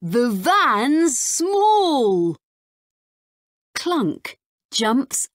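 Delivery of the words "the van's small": put-on slow, higher, marvelling child; amazed. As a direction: say it slowly in wonder. Max amazed.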